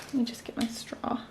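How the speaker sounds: breathy whispered aside; softly whispered aside to the camera. Real, not acted. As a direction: drop to a whisper as if muttering to yourself. Quiet aside while fetching straw.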